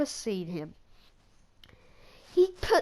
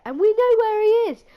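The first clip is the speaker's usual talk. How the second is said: exasperated; 10 semitones higher, 10 dB louder than usual. Real, not acted. Exasperated reviewer complains about plot.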